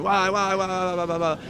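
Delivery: rapid repeated babble of mock protest; mock yelling. Angry wrestler yelling at his partners, mock yell. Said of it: "he's yelling".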